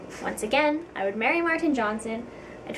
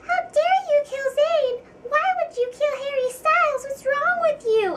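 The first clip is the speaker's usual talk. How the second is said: high, loud mock-outraged preteen fangirl; angry fangirls; 4 dB louder than usual. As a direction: squeal it like an outraged teen fan. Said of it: "pissed off preteen fangirls going".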